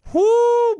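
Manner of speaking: a sudden, very high, loud whoo of disbelief. Real, not acted. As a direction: let out a shrill-free but piercing high whoo. Stunned by the huge bet.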